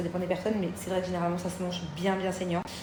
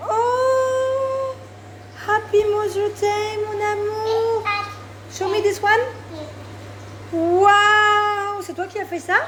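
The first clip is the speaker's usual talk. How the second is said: high sweet mom voice; 13 semitones higher, 7 dB louder, much slower than usual. Real, not acted. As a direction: coo over the gift like a proud mom. Mother cooing to child.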